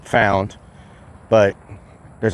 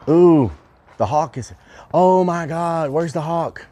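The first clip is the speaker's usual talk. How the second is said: high-pitched excited gasp of discovery; 7 semitones higher, slower than usual. Real, not acted. Hearing the hawk and looking for it, excited. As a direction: react with sudden, real excitement, pitch jumping up. Suddenly hears hawk, searches for it.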